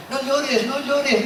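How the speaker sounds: high, sing-song No llores, no llores, fussing; mock comforting. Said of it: "como algunos dicen".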